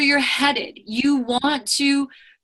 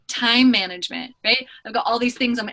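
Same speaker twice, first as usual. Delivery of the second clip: ordinary talk, nothing marked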